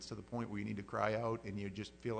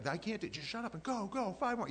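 higher pushy coach voice, lightly played; mock coach yelling; 11 semitones higher than usual. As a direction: egg the kid on like a coach.

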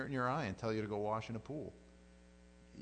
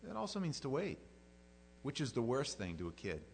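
normal talking voice

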